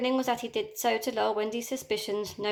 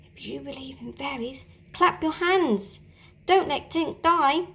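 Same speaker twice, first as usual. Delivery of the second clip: calling out; 5 semitones higher than usual. A narrator's reading. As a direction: plead out to every dreaming child. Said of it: "Peter called to every child".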